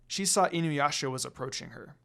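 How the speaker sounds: ordinary delivery, nothing marked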